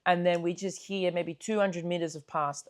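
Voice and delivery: normal talking voice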